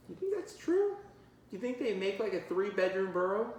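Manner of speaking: high, surprised question; wide-eyed wonder at a three-bedroom burrow; amazed disbelief. Real, not acted. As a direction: marvel at the idea, voice jumping up. Reader's amazed aside.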